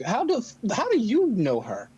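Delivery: pressing question. Acted. Intrigued demanding question.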